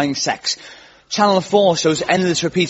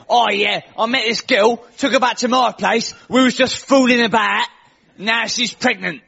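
put-on Essex accent; 5 semitones higher than usual. Quoting an Essex guy on Trisha, in Essex accent. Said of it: "I've got to get the accent".

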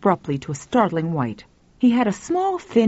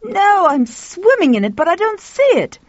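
high, loud, exasperated outburst; frustrated; 13 semitones higher, 6 dB louder than usual. A narrator's reading. Man upstairs answers the woman about his stuck work, high and exasperated.

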